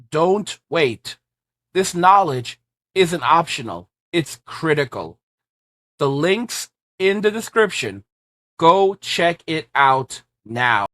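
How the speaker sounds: urgent, punchy promo pitch; ad read. Acted. Host pitching his guide to viewers, urgent ad read. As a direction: sell the investment guide hard.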